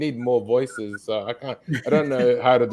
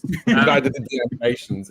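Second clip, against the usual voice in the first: usual speaking voice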